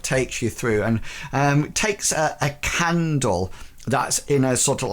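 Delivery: normal talking voice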